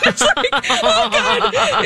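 high laughing exclamation; breathless real laugh. Real, not acted. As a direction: lose it to a laugh mid-line. Laughing at the no-pants story.

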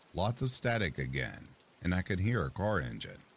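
ordinary delivery, nothing marked

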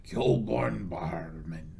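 low, drawn-out whispery voice; put-on voice. Host thanking a sponsor by name in a slow, low put-on voice.

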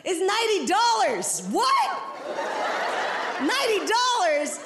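high-pitched mock astonishment; incredulous, pitch leaping up; shocked cop. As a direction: react in comic disbelief at the price. Cop shocked at candle price.

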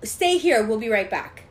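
imitated brisk parent; parental order. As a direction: call it out like a departing parent. Parents giving orders, retold.

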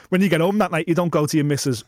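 ordinary delivery

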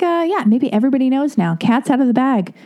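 normal talking voice

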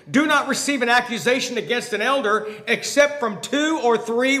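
loud, driving pulpit delivery; preaching hard. Acted. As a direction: proclaim the verse to the whole church. Preaching scripture at full force.

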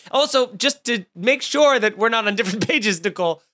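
ordinary talk, nothing marked

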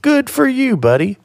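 very high, exaggerated praise; sounds almost sarcastic. Real, not acted. Co-host congratulating a buddy on leaving the phone, almost sarcastic-sounding. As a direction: sing it out high, but mean it. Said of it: "almost sounded like I was being sarcastic".

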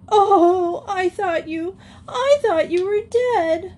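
very high, tearful acted wail; sobbing. A narrator's reading. Joey, who thought her sister was dead, sobbing. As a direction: sob it out in a high child's voice. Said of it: "sobbed Joey".

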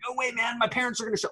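retold fretting, high without volume; mock panicked refusal. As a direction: protest in a pinched-up high pitch.

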